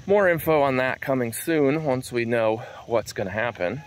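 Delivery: ordinary delivery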